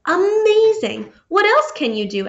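amazed. Acted. Beaver amazed after Franklin eats the pie, thrilled. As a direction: gasp it out in awe. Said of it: "asked Beaver".

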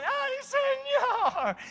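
long, very high, drawn-out lament; pleading wail; anguished cry. Acted. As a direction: cry out to God in distress. Sinner feels conviction.

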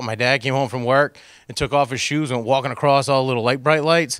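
normal talking voice

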